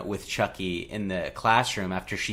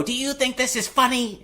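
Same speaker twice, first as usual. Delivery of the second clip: much higher mock scolding teacher voice; stern teacher; 3 dB louder than usual. Teacher scolds Andy in class, high mock scolding.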